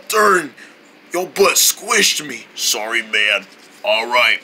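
ordinary talk

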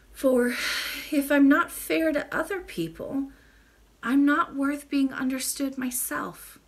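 a lightly voiced earnest girl; young Irene. A narrator's reading. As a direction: Irene promising to be fair, sincere. Irene voiced in read story.

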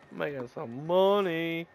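ordinary delivery

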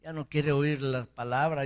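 usual speaking voice

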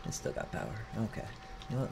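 normal talking voice

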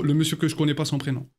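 ordinary talk, nothing marked

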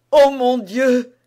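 loud, high cry of dread; frightened cry. A narrator's reading. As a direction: cry out in alarm, drawing the words out. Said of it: "S'écria Anne d'Autriche, avec un accent d'effroi". (cri d'effroi, fort et aigu)

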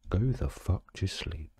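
normal talking voice

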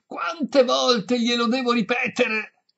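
high, slow, loud demand; exasperated shout. A narrator's reading. Violinist to deaf old lady, exasperated high shout. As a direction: shout it, at the end of patience.

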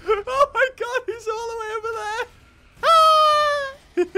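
high, loud burst of laughter through the words; can't stop laughing; laughing hard. Real, not acted. Gamer laughs as the dino flies away, laughing hard.

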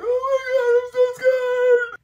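high, slow frightened squeal; scared squeal. Retold or imitated. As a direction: squeal like you're terrified on a ride. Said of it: "I sat down and I was like".

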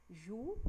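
Ju held long with rising pitch; drawn-out stressed syllable. Shown as a demonstration. Teacher isolating the tonic syllable, Ju drawn out. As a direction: pull on the syllable Ju. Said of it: "tá puxando mais".

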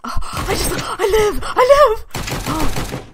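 high, loud cry of survival; squealing triumph. Real, not acted. Gamer shrieking that she survived. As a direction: shriek it in relief.

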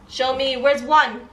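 ordinary talk, nothing marked

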